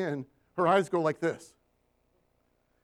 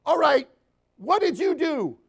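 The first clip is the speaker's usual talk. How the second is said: high, loud accusing demand; retold boss bursting in, loud and high; angry boss; 8 semitones higher, 7 dB louder than usual. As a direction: storm in and demand answers. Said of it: "she bursts into my office and she goes".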